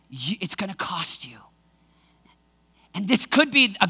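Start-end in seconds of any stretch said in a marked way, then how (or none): 0.1-1.4 whispered warning. Acted. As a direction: breathe it like a secret.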